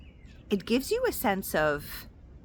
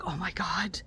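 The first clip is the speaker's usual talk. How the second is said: whispered, stewing over it; dismayed whisper; 5 dB quieter than usual. Retold or imitated. Driving home still stewing over the insult, whispered dismay. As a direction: breathe it out like you can't let it go. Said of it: "carrying that thing with you and being like".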